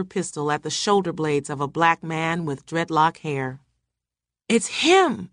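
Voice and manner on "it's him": high, alarmed cry; screaming. A narrator's reading. Wife recognises the intruder, screamed alarm. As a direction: scream it in narrated restraint, pitched up. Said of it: "Margaret screamed".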